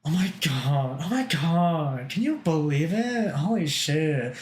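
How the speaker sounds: put-on gossipy Valley Girl, swooping high and drawn out; hammed-up Valley Girl, stretched vowels. Shown as a demonstration. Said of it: "May I hear it?".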